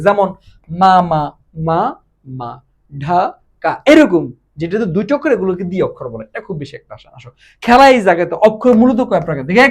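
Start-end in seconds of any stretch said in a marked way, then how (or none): 0.8-3.7 মা, মা, মা, মা, ঢা, কা each said alone; separated syllables. Shown as a demonstration. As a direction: pause between the beats in মা, মা, মা, মা, ঢা, কা. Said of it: "যেখানে দুইটি অক্ষর থাকে".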